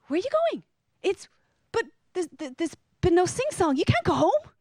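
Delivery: high, incredulous protest; dismayed disbelief. Retold or imitated. Dismay as wedding guests leave.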